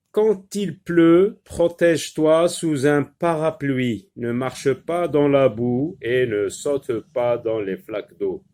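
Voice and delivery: deliberate dictation-style read-aloud; teacher reading aloud. Shown as a demonstration. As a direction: read it out deliberately for young learners. Teacher reads the lesson text aloud.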